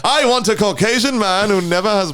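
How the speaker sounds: high prim passenger demand. Retold or imitated. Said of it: "Imagine the racist that would go".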